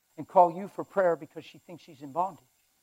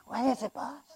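high, soft imp voice; hushed high-pitched henchman; little imp; 7 semitones higher, 9 dB quieter than usual. Acted. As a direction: play a small, servile minion. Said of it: "the little imp comes over".

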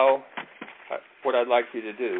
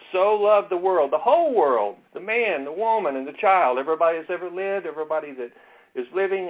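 preaching build-up; 7 semitones higher, 6 dB louder than usual. Shown as a demonstration. Teacher showing the class how to expand a verse, preaching. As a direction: preach it out, rising on each phrase. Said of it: "It'd be like".

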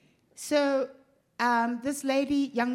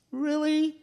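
high, louder sarcastic "really"; sarcastic disbelief; 6 semitones higher, 4 dB louder than usual. Retold or imitated. To the waitress who claims they're busy, sarcastic disbelief. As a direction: make it plain you don't believe her. Incredulous at empty restaurant.

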